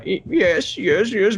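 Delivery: high, stammered reluctant agreement; protesting organ donor. Acted. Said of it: "two organ donor guys show up to collect".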